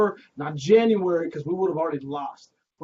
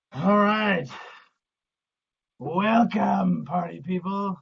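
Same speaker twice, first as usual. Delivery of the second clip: muffled; 7 dB louder than usual. Real, not acted. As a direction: voice muffled by a box. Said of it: "I'm wearing a box on my head".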